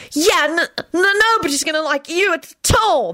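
put-on high, stammering boyish threat; threatening. A narrator's reading. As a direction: attempt a menacing warning. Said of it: "declared threateningly".

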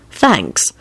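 plain reading voice

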